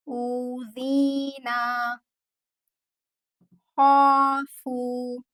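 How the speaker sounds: ऊज़ीना and खौफू said very slowly, each long vowel held two counts. Shown as a demonstration. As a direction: stretch every madd letter. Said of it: "to second के लिए extend करके पढ़ेंगे". (हर मद्द को दो second खींचें)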